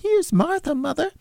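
put-on high falsetto girl's voice; young girl. A narrator's reading. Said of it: "said a girl".

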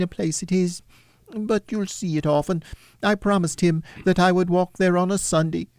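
tender father. Acted. Bob tells his wife of the grave, lightly voiced and tender. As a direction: speak gently through grief.

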